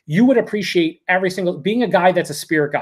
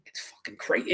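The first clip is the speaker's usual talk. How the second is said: awed whisper; 13 dB quieter than usual. Real, not acted. Reviewer whispering to viewers, stunned by the beer, hushed whisper.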